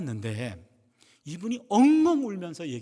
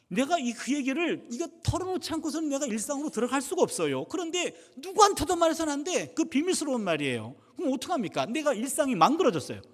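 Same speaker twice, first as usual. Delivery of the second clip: high-pitched, worked-up complaint; agitated venting; 7 semitones higher than usual. Retold or imitated. Pastor unable to keep a secret, agitated. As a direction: let it spill out high and desperate.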